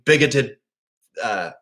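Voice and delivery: normal talking voice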